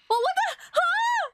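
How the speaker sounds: high-pitched baffled yelp; real surprised squeak; startled. Real, not acted. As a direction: blurt it in shock. Startled by the show.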